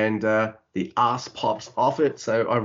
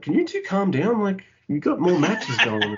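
mock plea; 8 semitones higher than usual. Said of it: "I'm like".

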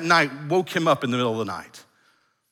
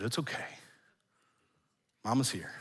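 much softer, gentle comfort; hushed soothing mom; 4 semitones lower, 13 dB quieter than usual. Retold or imitated. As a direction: lull the child back to calm. Mother soothing the toddler.

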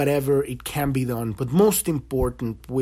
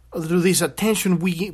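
ordinary delivery

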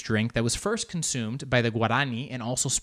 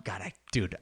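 usual speaking voice

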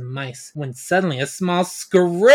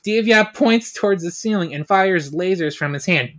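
ordinary talk

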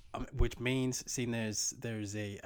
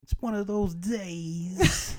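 mock crying; 9 semitones higher, 8 dB louder, much slower than usual. Mock crying, high and slow.

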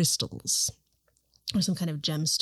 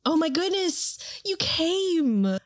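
excited gushing; 10 semitones higher, much slower than usual. Acted. Host greets guest gushing.

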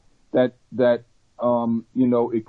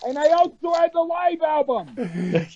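far from mic; 2 semitones higher than usual. Real, not acted. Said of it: "You're back to being muddy again".

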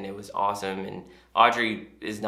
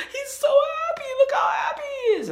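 put-on very high, squeaky overjoyed child; tearfully happy; 4 dB louder than usual. Daughter watching hungry man eat, mock tearful joy. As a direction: squeal it like a girl nearly crying with joy. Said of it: "almost in tears with how happy she is".

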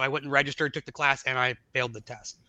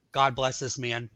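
ordinary talk, nothing marked

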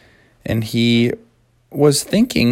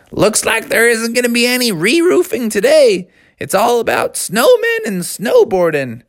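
put-on high, excited young frog; gleeful Froggy; 8 semitones higher than usual. Froggy tells dad roofing is off, high and gleeful. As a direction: crow about it with excitement. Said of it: "So Froggy said".

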